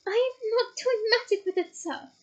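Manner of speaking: high squealing house-elf. Acted. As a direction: squeal the denial in terror.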